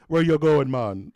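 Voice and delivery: loud, high Jamaican driver impression; Jamaican accent. Jamaican van driver asking passengers where to, Jamaican accent. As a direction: call it out like a Jamaican cabbie. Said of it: "just Jamaican dudes".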